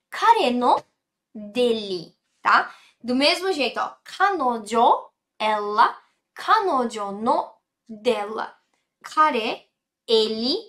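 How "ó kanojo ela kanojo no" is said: ordinary talk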